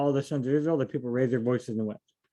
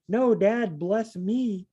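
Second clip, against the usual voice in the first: higher, urgent plea to dad; pleading son; 7 semitones higher, much slower than usual. Pretend. Esau pleading with his dad after the blessing is gone, raised pitch.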